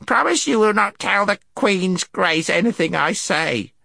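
high, anxious creature voice; high-pitched nervous pleading; whimpering gnome. A narrator's reading. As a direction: beg in a squeaky gnome voice. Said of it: "whimpered the gnome".

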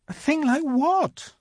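high-pitched, baffled Lucy; questioning little girl. A narrator's reading. Lucy asking the faun what he means, puzzled. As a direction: ask in a small, high voice.

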